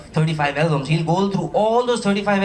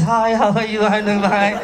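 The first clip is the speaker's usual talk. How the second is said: higher-pitched caricature of a simpering guy; high, exaggerated mock-sweet greeting; mocking guy's voice; 5 semitones higher than usual. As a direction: put on a silly high voice to mock him. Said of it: "he talks like this".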